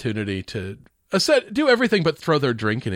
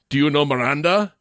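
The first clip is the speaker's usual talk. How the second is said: put-on Arnold accent. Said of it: "Arnold just goes".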